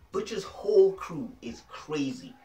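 normal talking voice

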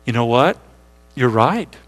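ordinary talk

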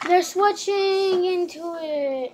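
high and loud triumphant cheer; excited. Pretend. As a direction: celebrate the finished trick. Pretend magic show.

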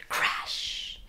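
breathy whispered crash. A narrator's reading. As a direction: hush out the crash sound. Sound effect.

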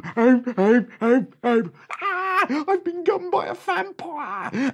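very high loud panicked cries; screaming for help. A narrator's reading. Bitten bandit shrieking high and loud.

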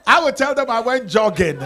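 laughing punchline. Real, not acted.

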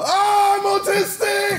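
sudden high, loud shriek; excited shout. Acted. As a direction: blurt it out, shrieking. [name]'s put-on character bursts out.

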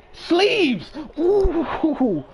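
high loud cheer; shouting at a great play; hyped cheer. Real, not acted. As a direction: celebrate the shot loudly.

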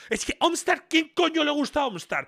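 real worked-up complaint; indignant rant. Real, not acted. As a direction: rant in disbelief. Ranting indignantly at the quiz.

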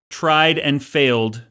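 usual speaking voice

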